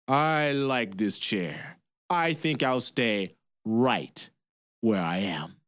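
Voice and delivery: low, slow, smug refusal; defiant giant. Acted. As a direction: settle in smugly. Giant defies woman.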